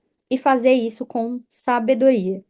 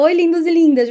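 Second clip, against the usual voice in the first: bright, higher, louder catchphrase greeting; her signature greeting; 5 semitones higher, 5 dB louder than usual. Shown as a demonstration. Influencer greets her followers with her signature cheerful catchphrase. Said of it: "Quando eu falo".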